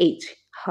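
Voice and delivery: এইচ, হ on pure breath; হ whispered as a bare h; breathy h demo. Shown as a demonstration. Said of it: "আর next রয়েছে হ".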